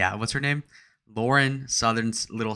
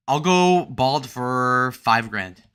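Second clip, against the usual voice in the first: slow drawn out; 5 dB louder, much slower than usual. Pretend. Slow mock offer.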